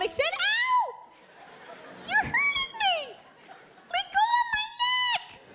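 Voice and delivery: insistent falsetto mock wail; very high, stretched-out imitation of a hurt woman; lady in pain. Lady repeating herself to her boyfriend, hammed-up high wail. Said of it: "I hear this lady's voice".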